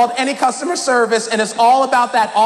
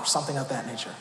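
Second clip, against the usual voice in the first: ordinary delivery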